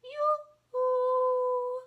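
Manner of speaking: very high sing-song call. Acted. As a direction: sing out from afar. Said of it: "a voice called".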